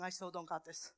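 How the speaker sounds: a low quiet aside to herself; low hushed inner voice; inner thought, low and soft. Retold or imitated. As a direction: mutter the private doubt under the breath. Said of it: "Inside, I was thinking".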